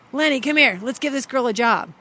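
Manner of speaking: loud, high summons across a set; imitating a boss hollering for an assistant; calling someone over. Acting director calling production manager over.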